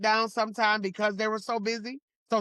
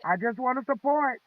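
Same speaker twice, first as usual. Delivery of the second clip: quoting late husband; 3 semitones higher, faster than usual. Retold or imitated. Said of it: "He'd say".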